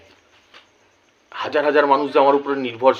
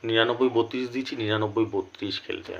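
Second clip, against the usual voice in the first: subdued, pained repeat; 2 semitones lower, 7 dB quieter than usual. Real, not acted. Vlogger with a headache repeats the result, softly. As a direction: let the voice sink, sparing an aching head. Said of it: "মাথায় ব্যথা করছে".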